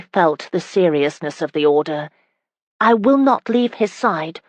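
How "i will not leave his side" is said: put-on higher, earnest boy's vow; frowning promise. Young Thomas vowing to Geoffrey he will stay close, frowning. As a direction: promise it solemnly, a touch of a frown. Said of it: "he said, frowning".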